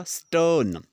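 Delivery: "स्टर्न" with a long vowel and no R; silent British R. Shown as a demonstration. Teacher repeats what he said, R dropped in "स्टर्न". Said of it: "आर मैंने pronounce नहीं किया है".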